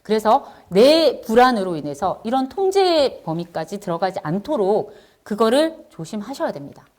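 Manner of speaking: ordinary talk, nothing marked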